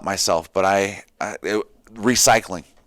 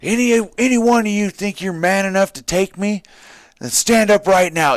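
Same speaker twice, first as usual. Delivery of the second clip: drill sergeant yell; 8 semitones higher than usual. Retold or imitated. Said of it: "he says".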